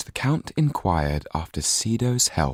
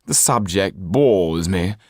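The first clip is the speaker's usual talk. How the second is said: bored disdain; 3 semitones higher, 4 dB louder, slower than usual. A narrator's reading. Marquis voiced.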